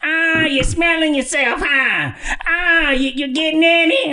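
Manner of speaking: high teasing grandmother; grandma voice. Retold or imitated. Grandma teases her teenage grandson, high teasing voice. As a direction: needle the boy in a high old-lady voice. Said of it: "do her voice".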